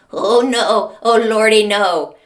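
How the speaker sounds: big, loud wail of alarm; loud dread. Retold or imitated. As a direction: escalate into a hammed-up panicked cry. Retold dread, building alarm.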